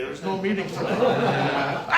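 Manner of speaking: slow, laughing shout on the punchline. Real, not acted. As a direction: burst out laughing at how absurd it is. Punchline of a funny story.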